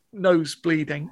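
ordinary talk

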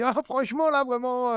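high, mocking impression of a fellow comic; Edgar's voice. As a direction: pitch way up and tease him. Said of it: "j'adore son, son thème de voix".

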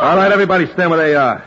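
forceful, high-pitched command; commanding shout. Acted. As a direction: take control of the room at gunpoint. Gunman orders room to freeze.

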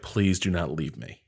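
a soft, small, frightened plea; panicked plea. Retold or imitated. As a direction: beg quietly not to be left alone. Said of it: "in a panic, turns to Kitty".

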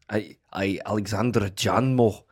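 put-on a lightly done Scottish accent breaking into laughs. A host trying Scottish for the accent game names the bush baby, laughing, in a light Scottish accent. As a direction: push through a Scottish brogue while giggling. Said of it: "can I do Scottish?".

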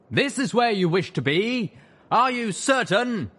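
high, loud, slow echoing ghoul knight; booming ghoul voice. Acted. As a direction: boom like a ghost in a tank. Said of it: "voice echoed painfully inside the sky tank".

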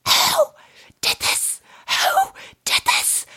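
slow, whispered, each word forced out; seething whisper demand. Retold or imitated. Mum confronting kids over the poo, slow seething whisper. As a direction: imitate a furious mum in a whisper. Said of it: "So Kerry starts going".

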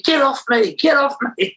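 high mock yell of get off me; high squealing mimicry; mimicking the guy. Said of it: "he was going to me".

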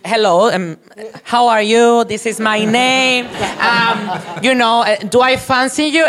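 high, sing-out introduction in imitation; slow, high mock small talk; mock polite introduction. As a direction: act out a polite chat-up, pitched well up. Acting out a polite Grindr introduction.

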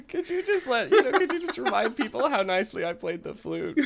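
a real laughing plea. Real, not acted. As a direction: try to ask while cracking up. Laughing through his own joke.

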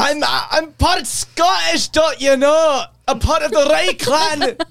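big slow mock-Scottish boast; Scottish accent. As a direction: declare it in a hammed-up Scots accent. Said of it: "your Scottish accent".

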